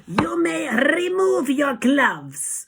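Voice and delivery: very high, slow, harsh shout; Grand High Witch. A narrator's reading. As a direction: screech the order with heavily rolled R. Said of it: "she shouted".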